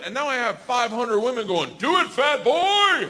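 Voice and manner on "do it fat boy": high, drawn-out mock crowd yell; imitating hecklers with a stretched-out shout; imitated crowd egging on. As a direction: holler it slowly like a goading crowd. Crowd of women egging him on.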